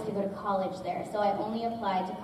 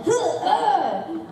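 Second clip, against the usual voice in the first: effort yell; 9 semitones higher, 5 dB louder than usual. Shown as a demonstration. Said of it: "it's more like yelling".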